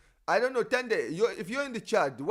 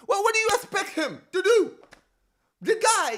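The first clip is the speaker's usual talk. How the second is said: exasperated outburst; 13 semitones higher, 8 dB louder than usual. Real, not acted. Exasperated rant about coach's schedule.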